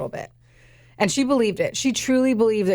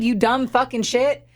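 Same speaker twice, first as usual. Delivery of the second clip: usual speaking voice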